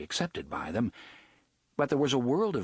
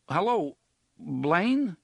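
raised, higher call into a speaker, unsure anyone answers; nervous calling out; 7 semitones higher, 3 dB louder, much slower than usual. Acted. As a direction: call out tentatively, voice lifted and tense.

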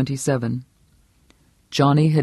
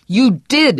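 louder, higher bellow; 9 semitones higher, 5 dB louder than usual. Acted. Husband bellowing at his lying wife, loud. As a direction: slam the denial back at her. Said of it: "he bellowed".

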